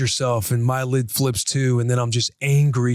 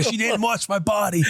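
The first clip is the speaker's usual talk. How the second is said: imitating Rue; 8 semitones higher than usual. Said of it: "She's yelling at Lola".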